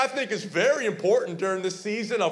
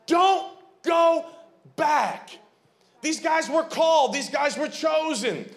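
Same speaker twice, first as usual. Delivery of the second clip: preaching at full force; 8 semitones higher than usual. Acted. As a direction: drive the message home to the congregation.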